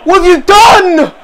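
high, loud, drawn-out outcry; incredulous shout. Real, not acted. Fan reacting to Chalobah's play, incredulous shout. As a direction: cry it out in disbelief, stretched. Repeats it louder after a blunder or rescue.

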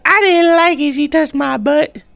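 very high, mock-offended squeal; squeaky high squeal. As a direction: squeal it like a flustered cartoon girl. Squealing about the enemy grabbing him.

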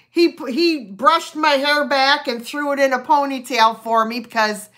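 ordinary talk, nothing marked

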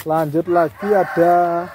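slow, loud sales announcement; loud cheerful hawker calls. Real, not acted. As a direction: call out the next item like a market seller. Pedagang menawarkan pohon berikutnya. (seruan pedagang yang lantang dan ceria)

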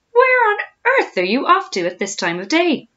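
high, loud, incredulous question; loud nosy surprise. A narrator's reading. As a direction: call it out in high-pitched astonishment. Hippo hails passing crocodile.

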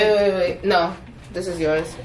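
ordinary delivery

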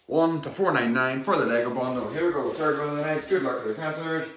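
upbeat raffle draw call; raffle caller. Acted. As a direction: announce the draw to the stream.

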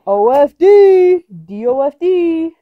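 loud, slow letters spelled out high; drawn-out spelling. Real, not acted. Reading the ball's letters aloud to the camera. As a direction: call each letter out slowly and loud.

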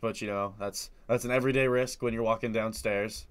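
low, soft deadpan show-host read; mock vlog intro. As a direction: present the stairs like a bored host.

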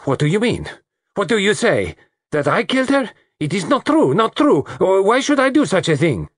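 high, furious outburst; spitting it out. A narrator's reading. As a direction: spit out indignant denials, pitched very high. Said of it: "She spat out".

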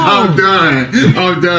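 loud, high I'm done shout; loud yell. Real, not acted. Player shouts to teammates that he quits, loud. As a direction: yell it like you've truly given up. Giving up amid the chaos.

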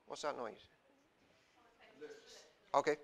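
usual speaking voice

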